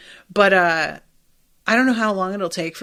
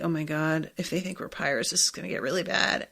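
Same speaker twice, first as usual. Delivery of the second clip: retold worry, much softer; hushed worried aside; 4 semitones lower, 9 dB quieter, faster than usual. Podcaster voicing a worried pirate companion's thought, hushed aside. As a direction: mutter it softly as an imitated worrier. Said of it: "And Nami's like".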